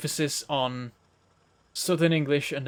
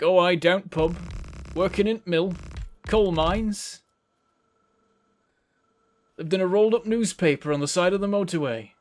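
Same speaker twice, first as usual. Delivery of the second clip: louder, higher hammed-up broad Yorkshire accent; mock his northern family in thick Yorkshire; 4 semitones higher, 4 dB louder than usual. Streamer jokes about his northern family, hammed-up Yorkshire accent. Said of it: "That's Yorkshire".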